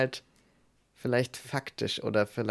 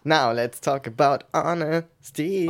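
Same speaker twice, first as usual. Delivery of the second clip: slow, louder host announcement; presenter voice; 4 semitones higher, 5 dB louder than usual. Pretend. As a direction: announce the topic like a show host. Switches to English host voice.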